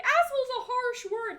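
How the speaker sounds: high, loud mocking whine; mock the Twitter scolds. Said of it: "the people on Twitter that's like".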